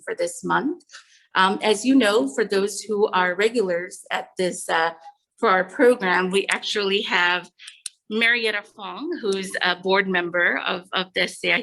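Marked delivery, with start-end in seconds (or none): none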